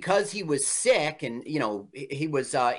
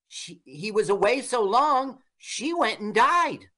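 mock dramatic; 6 semitones higher than usual.

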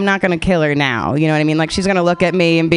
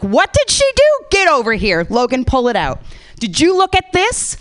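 mock scolding; 9 semitones higher than usual. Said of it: "am I supposed to be like".

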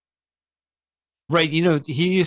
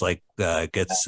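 usual speaking voice

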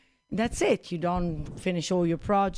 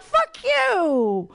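higher, snapping retort in a retold argument; mock outburst; 10 semitones higher, 3 dB louder than usual. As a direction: fire it back at the friend.

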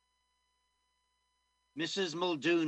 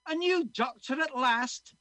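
put-on high, loud joyful Scottish old man; Scottish old man delighted at the news; Scottish accent; 12 semitones higher, 7 dB louder than usual. Old man welcomes the new doctor, joyful Scottish accent. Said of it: "I'm gonna be doing a Scottish accent".

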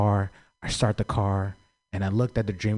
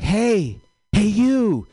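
high, loud call to a stranger; 13 semitones higher, 6 dB louder, much slower than usual. Acted. Guy in Oakland hailing a passer-by, high loud call. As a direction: holler for someone's attention, drawn high. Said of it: "this guy was like".

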